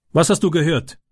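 lightly voiced gang leader, daring the boy to answer; challenging. A narrator's reading. Tyke confronts the caught boy, challenging. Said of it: "fragte Tyke herausfordernd".

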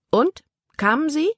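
sharp high questions; impatient challenge. A narrator's reading. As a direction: demand it impatiently. Libby challenges Caleb.

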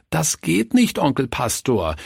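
put-on high-pitched child's wail; plaintive, high little voice; whining children. A narrator's reading. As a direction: whine in protest like a child. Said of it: "jammerten die Räuber".

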